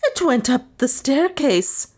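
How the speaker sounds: high-pitched frantic wail; wild cry. A narrator's reading. Maid reporting what she saw to mistress, wild cry. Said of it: "cried Lizzie wildly".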